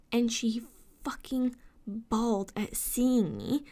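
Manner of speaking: ordinary talk, nothing marked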